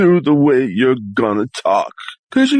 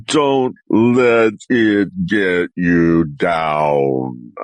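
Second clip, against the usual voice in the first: very slow and much lower, drawn out; a slow, low, sombre put-on voice; slow deep voice; 7 semitones lower, much slower than usual. Shown as a demonstration. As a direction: stretch every word low and slow. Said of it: "your voice has to be your voice".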